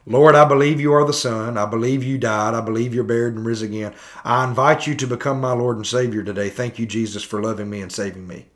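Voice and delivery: hurried, formulaic prayer, sinking at the end; rote prayer recital. Acted. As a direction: rattle off the prayer without pausing. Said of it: "Pray this prayer of repentance with me".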